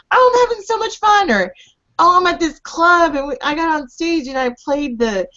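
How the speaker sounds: high-pitched gushing imitation; mocking husband's glee. Wife imitating husband's texts from the club, mock gleeful. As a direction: imitate him bragging in a mock-excited high voice.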